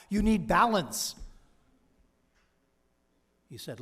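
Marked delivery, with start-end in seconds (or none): 0.1-1.2 emphatic advice. Retold or imitated. He told the groom-to-be to cut back.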